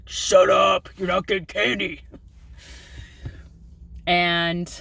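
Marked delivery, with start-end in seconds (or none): 0.1-2.0 mock snapping at kid. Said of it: "my response would be like".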